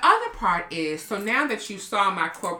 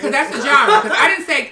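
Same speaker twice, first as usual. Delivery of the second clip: laughing; 6 semitones higher, 7 dB louder than usual. Real, not acted. Laughing while talking.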